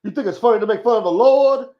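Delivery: high, loud, scolding Reverend Al impression; outraged reverend scolding a joker; scolding preacher. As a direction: chew him out like an angry preacher. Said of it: "he probably, uh, be like".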